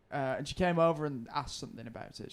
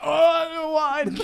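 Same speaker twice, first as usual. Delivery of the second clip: high, slow, drawn-out cry of remembering; granddad's sudden realisation; 11 semitones higher, 9 dB louder, much slower than usual. Retold or imitated. Granddad realising at the table, high slow "oh, no wine". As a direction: play the old man suddenly remembering the wine.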